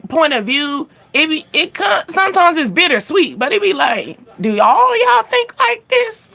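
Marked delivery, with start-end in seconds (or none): 4.4-6.3 high, drawn-out incredulous question; mock disbelief. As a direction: put on baffled disbelief at your friends. Mock question to male friends.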